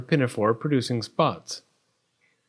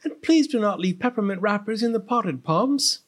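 high, fussy maid voice; raised-pitch servant's complaint; scolding parlor maid; 8 semitones higher than usual. A narrator's reading. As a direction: nag politely as the parlor maid. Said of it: "said the palace parlor maid Penny".

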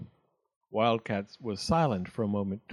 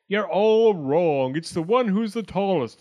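raised little arguing voice; higher, leaning hard on the objection; contradicting acorn; 6 semitones higher, 5 dB louder than usual. A narrator's reading. Tallest acorn contradicts the others, raised insistent voice. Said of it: "the acorns set up a commotion again".

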